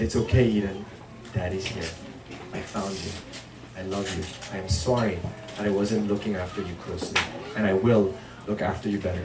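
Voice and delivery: put-on very soft, hushed reassurance to a crying boy; tender comfort. Father hugging his sobbing son, soft and tender. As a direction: hold him close and murmur it.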